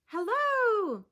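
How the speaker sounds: high, loud call; calling hello. Acted. Pip calling to a kelp gull, high and loud. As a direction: call out across the beach.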